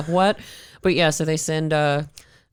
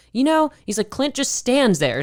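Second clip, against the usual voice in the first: much higher, slow explaining boss; 9 semitones higher than usual. Retold or imitated. Boss explaining the Clint Eastwood nickname, high and slow. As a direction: spell it out slowly, pitched way up.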